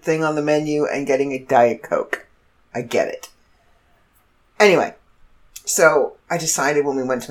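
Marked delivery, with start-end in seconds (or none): none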